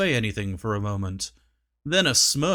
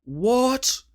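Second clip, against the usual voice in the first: intrigued king; 10 semitones higher than usual. Acted. Huncules asks intrigued.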